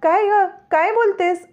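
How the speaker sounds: high, sharp demand of a stern elder; a stern older woman catching someone out, pitched high; sharp mother-in-law voice. A narrator's reading. Mother-in-law catches them lying.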